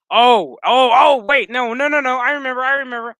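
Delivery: loud, high burst of remembering; sudden recall. Real, not acted. Storyteller suddenly remembering the plot, excited outburst.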